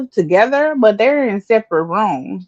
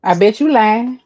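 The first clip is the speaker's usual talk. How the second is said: Southern saying. Shown as a demonstration. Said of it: "Keisha, I bet we're gonna be".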